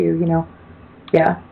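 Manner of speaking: ordinary talk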